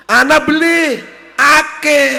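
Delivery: loud outburst. Acted. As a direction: blurt it out in a loud exclamation. Quarrel about the relatives. (bengok banter)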